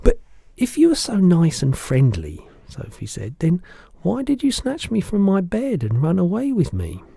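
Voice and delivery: put-on a curious little girl protesting; Sophie's voice.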